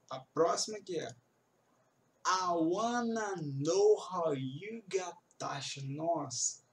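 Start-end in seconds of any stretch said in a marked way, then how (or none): 2.2-5.8 slow, with "wanna" linked smoothly; slow connected speech. Shown as a demonstration. Teacher slowly models connected speech for students. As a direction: drawl it slowly for learners. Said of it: "connected speech".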